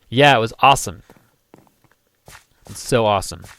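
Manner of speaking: loud opening, deadpan sarcasm; sarcastic. Real, not acted. Telling his wife about the windshield mishap, sarcastic. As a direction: pretend it was great, sarcastically. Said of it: "That was sarcasm".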